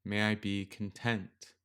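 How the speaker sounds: gentle metta meditation read; guided meditation. Acted. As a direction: ease the listener into stillness with long pauses.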